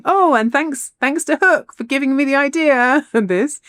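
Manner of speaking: high, singsong little thank-you; imitating a dainty, polite acknowledgement; polite mock thanks. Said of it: "sort of very politely says".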